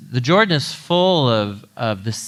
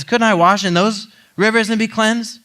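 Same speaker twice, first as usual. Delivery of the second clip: raging retort; 9 semitones higher, 4 dB louder than usual. Retold or imitated. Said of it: "He turned and went off in a rage".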